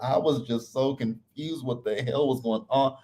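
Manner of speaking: ordinary delivery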